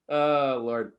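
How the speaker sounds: ordinary talk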